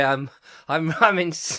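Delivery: ordinary delivery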